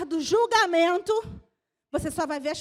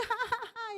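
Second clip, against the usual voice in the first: a quick real laugh at herself; short laugh; 6 semitones higher, 4 dB quieter than usual. Real, not acted. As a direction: laugh it off lightly. (risadinha espontânea)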